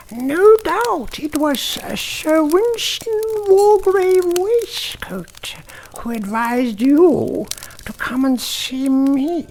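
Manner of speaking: very high, whispered witch voice; a witch sharing a secret, high and airy; whispering witch. Acted. The witch keeps speaking.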